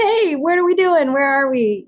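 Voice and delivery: high, loud pretend call to dad; mock phone check-in. Rehearsing a call to her dad.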